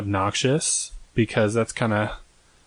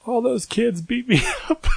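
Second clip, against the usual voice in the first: mock crying kid; 12 semitones higher than usual. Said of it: "I remember I was crying".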